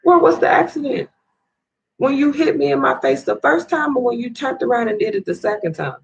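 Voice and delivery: high-pitched, heated accusation; real fury, voice pushed up high; hurt and outraged, pitch climbing; furious rant. Real, not acted. Confronting the man who hit her.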